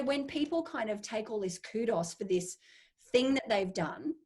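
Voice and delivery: ordinary talk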